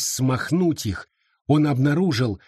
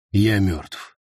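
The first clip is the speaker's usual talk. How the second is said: low beast voice; 5 semitones lower than usual. Acted. Beast answers flatly.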